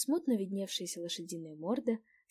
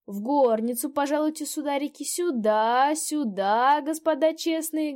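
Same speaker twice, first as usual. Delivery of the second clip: put-on higher, louder welcoming old peasant woman; old landlady; 7 semitones higher, 9 dB louder than usual. A narrator's reading. Old landlady ushers the gentlemen inside, bustling and loud. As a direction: fuss over the guests like a bustling old hostess.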